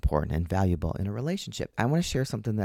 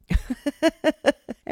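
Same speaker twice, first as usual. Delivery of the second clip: high burst of laughter; 5 dB louder than usual. Real, not acted. Couple laughing at misunderstanding.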